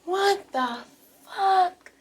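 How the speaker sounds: high, thin, stunned "what the fuck"; shocked squeak. Retold or imitated. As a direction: squeak it out in disbelief. Retold shocked reaction to neighbor.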